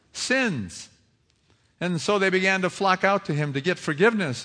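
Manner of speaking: loud, high preaching build; preaching at full force. Acted. Preacher to congregation, full-voiced preaching. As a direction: drive the story home to the congregation.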